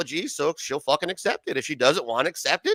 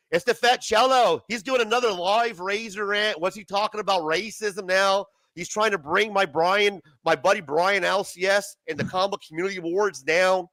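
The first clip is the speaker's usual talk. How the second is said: put-on Australian accent; 2 semitones higher than usual. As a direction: do a hammed-up Aussie, fading toward the end. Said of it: "The fucking accent".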